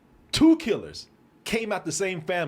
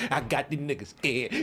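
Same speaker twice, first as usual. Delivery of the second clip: gloating bully. Retold or imitated. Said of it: "he was looking at me like".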